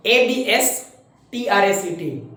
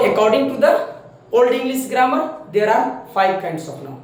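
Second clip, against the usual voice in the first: slow dictation; 2 semitones higher, much slower than usual. Shown as a demonstration. Teacher dictating slowly.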